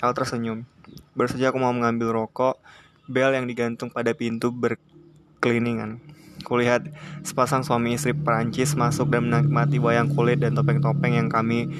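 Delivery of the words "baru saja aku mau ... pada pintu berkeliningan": ordinary delivery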